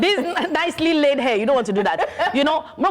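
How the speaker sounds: giggling while talking; laughing. Real, not acted. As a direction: laugh through the words. Laughing banter.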